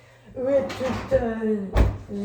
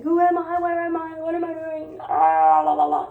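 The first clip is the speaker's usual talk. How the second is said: mock crisis; 7 semitones higher, 5 dB louder than usual. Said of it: "I'm just like".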